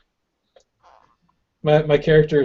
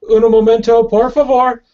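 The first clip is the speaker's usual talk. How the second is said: higher, louder, laughing joke call; yelled Spanish; 8 semitones higher, 6 dB louder than usual. Acted. Player yells back at the beast to wait, laughing. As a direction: yell back a playful stalling reply. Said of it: "I yell back".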